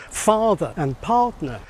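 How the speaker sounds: "father and partner" with the final R sounds dropped; non-rhotic pronunciation. Shown as a demonstration. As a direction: slowly say "father" and "partner" without pronouncing the R. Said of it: "didn't pronounce the R sounds".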